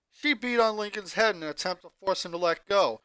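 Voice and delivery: plain reading voice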